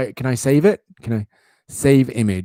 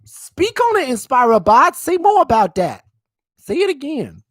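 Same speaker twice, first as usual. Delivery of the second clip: hyped-up fan; 13 semitones higher, 4 dB louder than usual. Retold or imitated. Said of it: "You're like".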